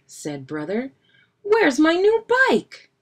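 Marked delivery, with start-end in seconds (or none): 1.4-2.9 very high, loud outcry; indignant outcry. A narrator's reading. Brother demanding his missing bike, indignant outcry. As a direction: wail it out in protest.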